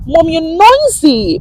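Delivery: put-on high, loud, slow child's complaint; imitating her son. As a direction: mimic the son scolding mom, drawn out. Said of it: "My son tell me say".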